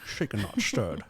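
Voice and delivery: low, soft Scottish spy; quiet slushy spy impression; Sean Connery. Shown as a demonstration. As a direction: drop low and slur the S's. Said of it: "your best Sean Connery".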